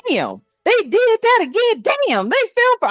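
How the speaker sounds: high mock exasperation; exasperated God. God fed up with people, high-pitched mock exasperation. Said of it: "He be like".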